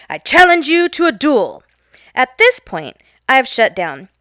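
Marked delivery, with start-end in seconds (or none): 0.1-1.6 proclaiming. Retold or imitated. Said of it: "and proclaimed".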